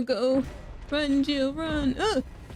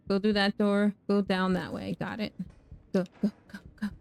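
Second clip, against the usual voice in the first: ordinary talk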